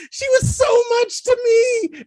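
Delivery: high, loud, hammed-up heartbreak; melodramatic wail. Host imitating a lovelorn former hookup, hammed-up wailing.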